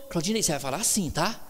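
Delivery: quick, low, soft reminder; imitated hushed correction. Pastor reminding the preacher how to speak, quick and hushed. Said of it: "você vai falar baixinho".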